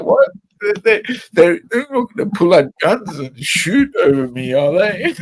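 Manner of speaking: worried American friend. Retold or imitated.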